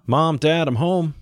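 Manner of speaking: put-on high-pitched boy calling to his parents; child's call through the house. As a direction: call out to mom and dad on coming home. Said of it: "Johnny called".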